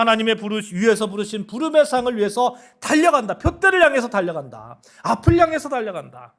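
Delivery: loud, driving sermon cadence; fervent preaching. Acted. Preacher urging the congregation forward, fervent preaching. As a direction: proclaim the verse like a rallying cry.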